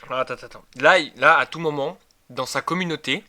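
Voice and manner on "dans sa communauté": usual speaking voice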